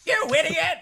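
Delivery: shrill furious yell; angry shout. Acted. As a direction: scream it at the fallen ally. GM voices angry Kenku.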